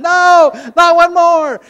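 high, loud comic scream; frantic inner voice. Acted. Little man in his head banging the wall, frantic shout. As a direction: wail it in hammed-up desperation. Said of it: "banging his head against the wall, going".

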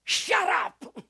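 high, sharp acted shout; shrieked rebuke; angry crowd hushing. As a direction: silence him like an irate crowd. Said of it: "they charged him and said".

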